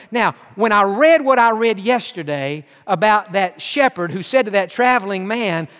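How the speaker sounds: ordinary talk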